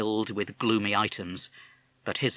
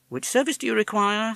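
higher, crisp switchboard operator; polite operator picking up the line; 12 semitones higher, 5 dB louder than usual. Acted. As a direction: answer the call like a telephone operator. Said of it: "said the operator".

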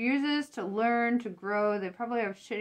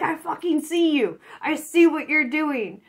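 louder, higher calling them out; confrontational callout; 6 semitones higher, 5 dB louder than usual. Pretend. Querent confronting the user who exploits her, defiant. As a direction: say it straight to their face, defiant. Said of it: "you're like".

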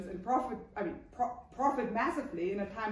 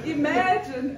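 laughing; 5 semitones higher, 10 dB louder, slower than usual. Real, not acted. Laughing at London house prices.

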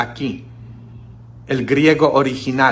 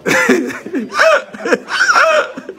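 big high laugh at his own joke; hearty laugh. Real, not acted. Priest laughing at his own joke, hearty laugh. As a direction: burst out laughing.

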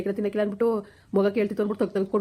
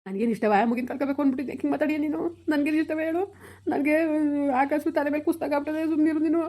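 pitched-up, upset protest; angry retort; 6 semitones higher than usual. Acted. Husband snapping at his wife that he doesn't want to lose their son either, upset and pitched high. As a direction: fire back, hurt and angry.

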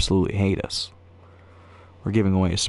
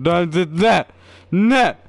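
building to a loud high that; stammer, getting louder; frustrated building outburst; 7 semitones higher, 5 dB louder, slower than usual. Real, not acted.

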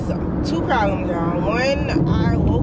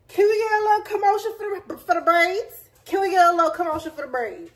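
high, fast hype for the braids; high fast hype call; 8 semitones higher, faster than usual. Real, not acted. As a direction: cheer yourself on, fast and high. Hyping her braids.

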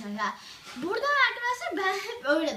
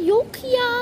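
dismayed whine; 8 semitones higher, 5 dB louder than usual. Real, not acted. Pen not found in the store.

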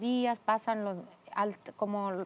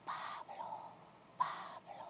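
slow, hushed whisper in the ear; 14 dB quieter, much slower than usual. Retold or imitated. Voice waking a sleeping mother with a name, whispered. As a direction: whisper the name like a mysterious night voice. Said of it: "me levanto y una voz".

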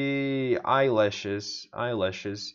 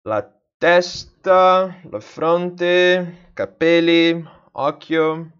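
louder, slow recital of the list; proud loud recital; 4 semitones higher, 10 dB louder, slower than usual. Real, not acted. Learner reciting her word list to viewers, loud and slow. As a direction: belt out the words learned so far.

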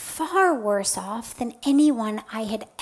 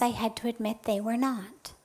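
ordinary talk, nothing marked

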